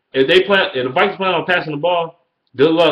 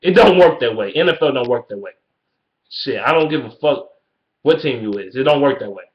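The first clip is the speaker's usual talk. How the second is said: usual speaking voice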